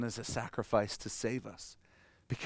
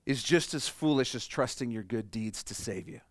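ordinary delivery

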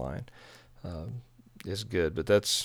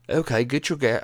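half-hearted mock British accent; attempted British accent; 3 semitones higher, 3 dB louder than usual. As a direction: have a shaky go at sounding British. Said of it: "in British accents".

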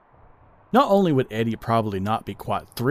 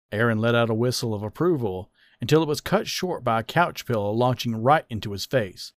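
plain reading voice

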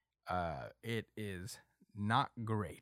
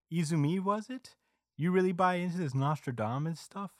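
higher-pitched mocking presenter voice; TV announcer; 6 semitones higher than usual. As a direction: put on a TV host needling a guest. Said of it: "the T V announcer is like".

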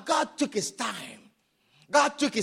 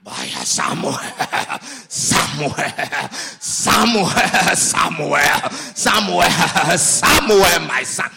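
mock God voice; 8 dB louder, slower than usual. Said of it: "your pastor should speak like".

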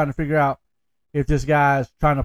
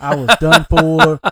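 laughing; 7 dB louder, slower than usual. Real, not acted. Laughing at childhood fear.

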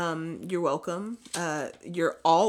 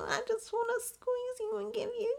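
put-on very high squeaky baby talk. To her pets, very high squeaky baby talk. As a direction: gush at the dogs with crying sweetness.

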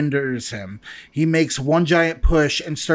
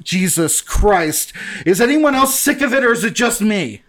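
exasperated complaining; 6 semitones higher, 3 dB louder than usual. Acted. Mo complains, fed up.

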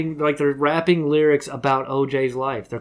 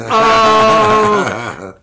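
a loud, high "Oh!" of victory; triumphant shout; 6 semitones higher, 9 dB louder than usual. Real, not acted. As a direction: crow it out loud, gloating.

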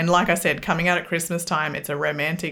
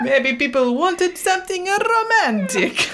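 hammed-up Italian accent, higher and laughing; bad Italian accent; 13 semitones higher, slower than usual. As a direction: read it like a cheesy Italian crooner. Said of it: "I'm gonna read it in a bad Italian accent".

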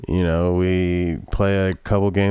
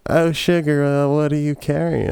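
sexy Southern woman; 7 semitones higher than usual. Retold or imitated. Tammy Lynn voiced again.

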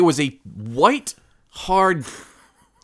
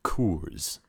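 low announcer voice; 7 semitones lower, 8 dB quieter than usual. Pretend. Announcing his go-to beer.

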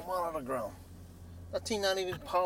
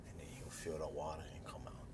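ordinary delivery, nothing marked

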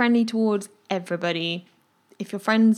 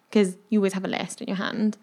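ordinary talk

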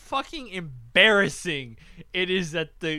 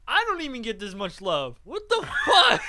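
mock jealous outburst; 6 semitones higher, 4 dB louder than usual. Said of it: "is sitting there going".

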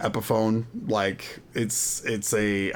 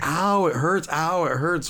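higher-pitched mock whine of pain; imitated hurting child; 5 semitones higher, slower than usual. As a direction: imitate a little kid saying ow. Imitates son complaining of pain.

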